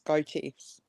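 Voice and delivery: usual speaking voice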